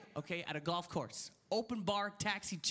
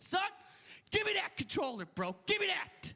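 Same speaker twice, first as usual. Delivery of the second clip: acted drunk demand; 11 semitones higher than usual. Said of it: "I was drunk. I said".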